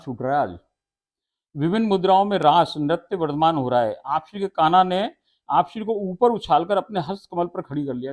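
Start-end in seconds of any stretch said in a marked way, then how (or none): 1.6-8.1 raised, loud, solemn telling of the raas; devotional recitation. Acted. Preacher reciting the raas scene to devotees, loud and solemn. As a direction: recite the holy scene to the listeners.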